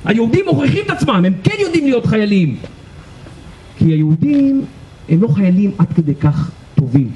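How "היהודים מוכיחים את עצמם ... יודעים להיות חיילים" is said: high-pitched delighted exclamation; imitated glee, pitched way up; overjoyed officer. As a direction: exclaim it like a thrilled, vindicated commander. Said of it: "שנורא שמח, שאומר".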